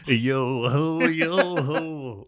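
high sing-song pirate chant; playful pirate yo ho. Pretend. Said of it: "they wouldn't go".

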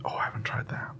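hushed, breathy aside; softly whispered curiosity. Real, not acted. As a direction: drop to a whisper as if letting on a secret. Intrigued aside about honey idea.

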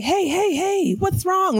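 high, slow gentle coaxing; soothing a child. Retold or imitated. Coaxing a crying little girl in the elevator, high and gentle.

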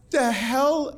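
high, squeaky incredulity; baffled, high-pitched protest; shocked disbelief. Retold or imitated. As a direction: pitch shoots up in alarm. Acted shock at doctor's words.

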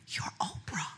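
soft breathy inner whisper; whispered thought. Retold or imitated. Guest silently marveling at Oprah, hushed whisper. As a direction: whisper it to yourself, in awe. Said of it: "And I'm thinking".

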